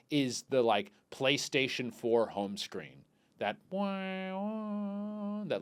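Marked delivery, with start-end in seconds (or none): 3.4-5.6 high, long-held soft synth note; soft imitated chime. Imitating the PlayStation home screen, soft held chime. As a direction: sing out a gentle console home-screen tone. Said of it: "like, soft".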